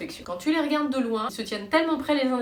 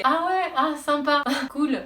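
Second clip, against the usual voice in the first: fake enthusiasm; 5 semitones higher, 5 dB louder, slower than usual. Forced polite reply to friends.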